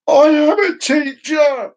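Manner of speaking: high, slow indignant shout. Retold or imitated. Donna protesting she is a teacher, high slow shout. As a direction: shout it back, outraged.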